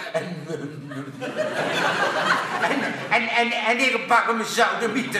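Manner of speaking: laughing stammer building to a threat; stutter. Acted. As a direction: stumble over en, then spit out the threat. Moos stutters angrily.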